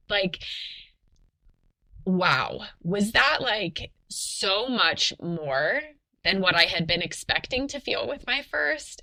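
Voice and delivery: ordinary talk, nothing marked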